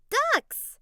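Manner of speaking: high, thrilled shout of discovery; squealing at the sight of ducks; excited cry. Acted. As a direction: blurt it out with delight. Spotting ducks at the lake.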